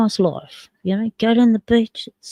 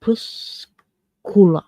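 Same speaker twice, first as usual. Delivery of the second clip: crepuscula pulled apart syllable by syllable; careful, halting try at crepuscula; slow, broken down; slower than usual. Shown as a demonstration. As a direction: sound out crepuscula slowly, with gaps. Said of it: "I'm sure it can be said faster".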